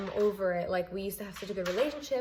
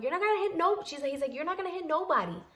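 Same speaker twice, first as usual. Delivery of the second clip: raised warning; 8 semitones higher than usual. Retold or imitated. Defending herself, voice raised high.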